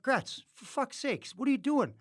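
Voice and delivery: high-pitched, exasperated plea; panicked. Retold or imitated. MC to Gretz over the surprise Prime Minister, panicked. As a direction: plead with him in mounting panic. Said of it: "So I'm in a panic".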